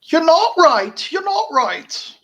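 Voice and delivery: mock Australian yell. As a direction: yell it like a bullied kid in a put-on Australian accent. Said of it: "He's screaming at the kids".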